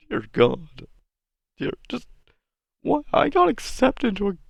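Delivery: high-pitched, despairing self-questioning; exasperated mock breakdown. Vlogger questioning his life before college, mock despair.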